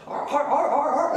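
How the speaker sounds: high seal barks in quick bursts; high-pitched mock seal voice; barking seal. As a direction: bark it out like a seal. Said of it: "the seal's like".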